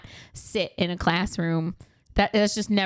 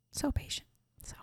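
whispered, half to herself; awed whisper; 13 dB quieter than usual. Real, not acted. Praising the teacher in a whisper. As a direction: breathe it out in admiration.

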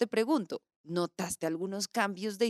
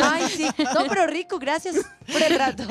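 much higher, louder, gushing goodbye; mock sweet caller; 10 semitones higher, 8 dB louder than usual. Chatty caller thanking the agent at the end, mock gushing. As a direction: gush your thanks like a caller who just wanted to chat.

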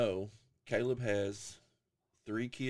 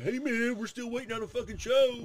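imitating eager fans; 14 semitones higher, 5 dB louder than usual. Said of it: "They're like".

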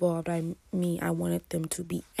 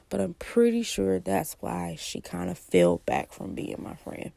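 normal talking voice

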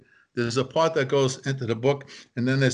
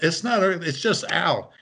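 mild mock yell, pitched higher; retold yell; 5 semitones higher than usual. Mother correcting the earthquake alarm, mild retold yell. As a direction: imitate a mother calling out a correction. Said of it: "my mother yelled".

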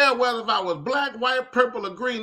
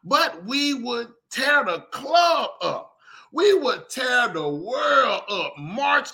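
passionate; 3 semitones higher, slower than usual. Real, not acted. Vlogger impassioned about division.

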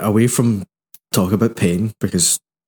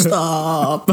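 higher, drawn-out mock yell for help; hammed-up distant cry; man crying out; 7 semitones higher than usual. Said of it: "the sound of a man going".

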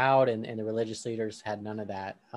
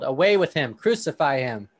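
louder read-aloud yell, first words strongest; crowd yelling; 3 semitones higher, 9 dB louder than usual. Acted. Crowd yelling back at Pilate, loud read-aloud shout. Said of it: "together they yelled".

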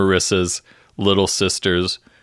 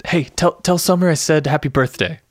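higher, eager Seth; a hint of nervous Seth; lightly voiced Seth; 7 semitones higher than usual. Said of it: "so Seth says".

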